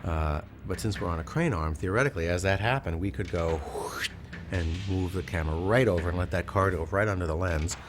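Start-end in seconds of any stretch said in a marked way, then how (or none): 3.6-4.1 drawn-out whoosh of something rushing past; imitated whoosh. Shown as a demonstration. Crew member showing the camera swinging over, drawn-out whoosh. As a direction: make a long whooshing noise.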